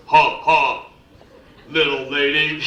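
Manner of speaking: lightly mocked martial-arts film heavy; a touch of cartoon henchman, loud on the laugh. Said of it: "they talk like that".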